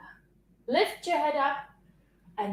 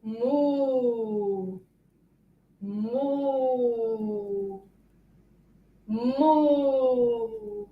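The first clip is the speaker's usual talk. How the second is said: long, drawn-out moo; slow imitation of a lowing cow; 4 dB quieter, much slower than usual. Shown as a demonstration. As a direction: moo like a cow, holding the vowel. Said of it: "What does the cow say?".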